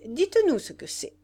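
high, loud eager request; calling out. A narrator's reading. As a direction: call it out across a group. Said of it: "cria Rouget".

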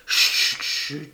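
long unvoiced-sounding shh, then chut; hushing. A narrator's reading. Young woman silencing the patient who leans toward her, hushing. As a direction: shush the listener firmly.

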